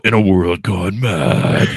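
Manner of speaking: slow, low trailer announcer; movie-trailer voice. Acted. Host mimicking a trailer opener for his guest, movie-trailer voice. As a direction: intone it like a film trailer. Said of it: "in the movie voice".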